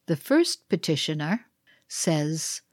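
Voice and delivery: ordinary delivery, nothing marked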